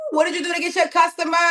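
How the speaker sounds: quick, thrilled prodding; excited eager question. Real, not acted. Host to member on her first customer, eager quick question.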